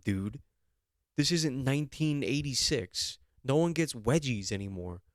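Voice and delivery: ordinary talk, nothing marked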